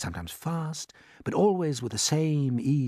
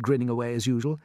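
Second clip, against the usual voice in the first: ordinary delivery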